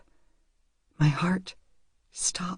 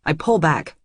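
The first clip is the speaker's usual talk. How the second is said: ordinary delivery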